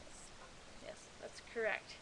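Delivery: hushed, breathy yes; soft, voiceless confirmation; whispered aside. Real, not acted. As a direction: whisper the agreement like a secret.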